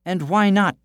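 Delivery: the lass demanding an answer from the miller. A narrator's reading. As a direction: insist on an answer. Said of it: "demanded the lass".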